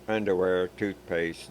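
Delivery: usual speaking voice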